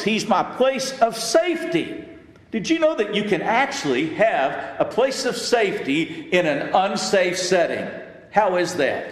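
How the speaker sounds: high, fervent preaching of the psalm. Acted. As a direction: lift it up like a preacher calling to the church. Preacher rises to full force.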